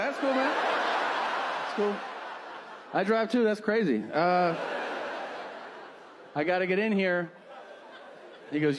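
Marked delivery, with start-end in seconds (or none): 0.0-7.3 high, forced-cheerful laughing; nervous chuckling politeness to escape; awkward polite brush-off. Retold or imitated. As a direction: fake enthusiasm while edging away.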